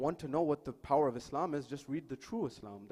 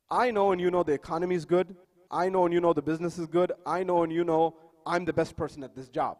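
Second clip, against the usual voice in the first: raised, louder voice hammering each point; insistent retold challenge; 4 semitones higher, 10 dB louder than usual. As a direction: press the boss, louder with every claim. Retold refugee confronting his boss.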